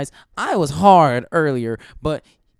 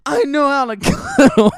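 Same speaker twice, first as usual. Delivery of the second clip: higher, louder indignant mock voice; Kyle protesting; 10 semitones higher, 5 dB louder than usual. Kyle insisting he can cuddle, higher and indignant. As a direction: protest in a pitched-up huff. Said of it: "you just hear Kyle".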